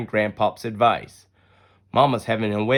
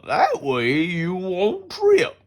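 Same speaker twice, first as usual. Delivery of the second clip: slow, higher grandpa voice with care; Grandpop's voice; 8 semitones higher, 3 dB louder, much slower than usual. Acted. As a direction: draw it out like a patient grandfather. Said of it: "Grandpop says".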